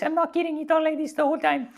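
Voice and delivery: very high, loud squeaky voice; imitating Simone's high voice. Telling the audience how his climbing partner sounded, very high and squeaky. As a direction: pitch way up like a funny little voice. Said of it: "very high and very funny".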